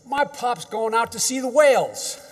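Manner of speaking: lightly voiced child boasting to her friend; imitating little granddaughter. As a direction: play the excited little girl. Voicing his granddaughter's words.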